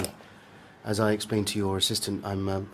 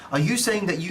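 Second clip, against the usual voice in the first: louder, higher insistent push; pressing confrontation; 7 semitones higher, 4 dB louder than usual. Acted. Reporter pressing the doctor as he is told to leave, louder and higher. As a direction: corner him with the question.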